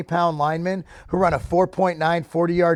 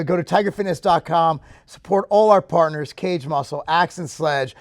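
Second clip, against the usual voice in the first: ordinary talk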